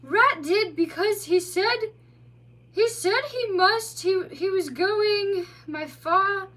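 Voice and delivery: high, slow, dreamy voice; drowsy otter. A narrator's reading. Tipsy otter answering the mole, dreamy. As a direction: drift off mid-sentence.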